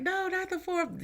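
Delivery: high, soft groaning protest; mock dismay. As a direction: whine at the unwanted card. Dreading the card that came up.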